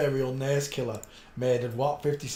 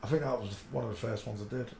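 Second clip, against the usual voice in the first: usual speaking voice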